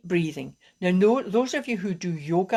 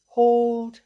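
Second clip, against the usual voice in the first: held breath; 5 semitones higher, 6 dB louder than usual. Shown as a demonstration. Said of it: "So we'll just try it".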